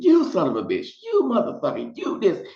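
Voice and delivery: high, shrieking imitation of brawling guests; high mock talk show fight; mock cussing guests. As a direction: squeal the insults like a catfight. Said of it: "people are cussing and fussing".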